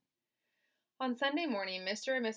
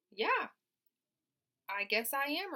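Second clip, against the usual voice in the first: high, louder voice for Wilbur; young pig voice; 6 semitones higher, 5 dB louder than usual. Acted. Teacher voicing Wilbur the pig, raised higher. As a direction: give Wilbur a raised boyish voice.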